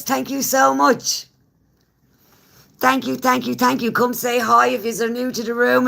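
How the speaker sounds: high-pitched excited holler to the room; shouting. Real, not acted. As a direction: shout it out to all the viewers, pitch held high. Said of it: "I don't know why I'm shouting now".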